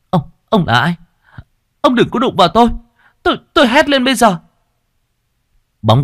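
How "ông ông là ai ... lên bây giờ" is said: high, panicked and faster; stammering in fright, rising to a scream; frightened young woman, much higher and louder; terrified girl. A narrator's reading. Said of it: "Duyên hoảng hồn".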